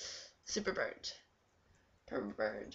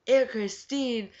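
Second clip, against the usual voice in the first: loud, slow, higher call of the name; 4 semitones higher, 8 dB louder, much slower than usual. Retold or imitated. Getting her aunt's attention for a cigarette, a loud slow call.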